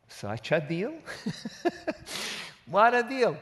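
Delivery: amused disbelief at the deal; laughing. Real, not acted. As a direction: laugh at the bargain.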